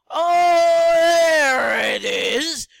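loud high surprised yell; excited discovery. Real, not acted. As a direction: shout out the find. Finding the saw.

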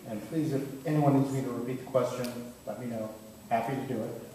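ordinary talk, nothing marked

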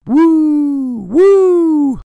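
loud, very high howling; big spooky wolf howl for the listeners. Acted.

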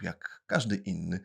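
plain reading voice

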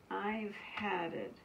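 a mother fed up and sighing; weary sigh. A narrator's reading. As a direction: sigh it out, defeated. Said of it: "she sighed".

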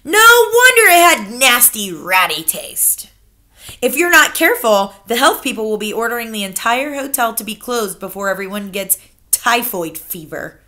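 loud, pressing grandmother; relentless grandmother. Acted. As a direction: keep hammering at him. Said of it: "my grandmother went on relentlessly".

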